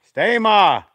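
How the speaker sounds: loud, high call across the yard; shouting. Real, not acted. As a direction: yell it out so she hears from far. Calling out to someone outdoors.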